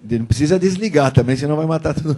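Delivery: loud, high call across the room. Real, not acted. Calls out to the women present.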